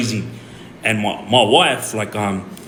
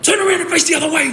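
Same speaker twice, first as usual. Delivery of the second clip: much higher, fast shouted order; cop yelling; much faster than usual. Retold or imitated. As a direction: bark the order at the wife like an angry cop. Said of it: "they full yelled at her".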